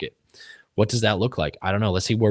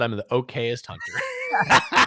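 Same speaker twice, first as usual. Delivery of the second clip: slow, much higher, jokey read-out; a playful, self-mocking slogan; mock hat slogan; much slower than usual. Guest jokingly reading out the hat's slogan, slow and mock-proud. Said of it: "the hat that said".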